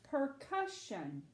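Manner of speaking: PERCUSSION said slowly as one joined word; blended word. Shown as a demonstration. Teacher puts the word together for Abby, PERCUSSION slow and whole. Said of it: "let's try to say the word".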